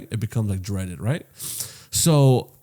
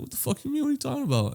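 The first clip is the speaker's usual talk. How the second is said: high, softer incredulous question; imitated bafflement; 13 semitones higher, 6 dB quieter, faster than usual. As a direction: play the confused barber, pitched right up. Barber baffled by the hair warning.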